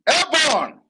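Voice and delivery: high, loud slogan cry; big high call, dropping at the end; rallying shout. Real, not acted. Sign-off slogan called out to crowd.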